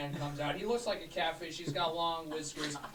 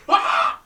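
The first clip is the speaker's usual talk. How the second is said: gulp then yelp; 18 dB louder than usual. Acted. Character drinks potion.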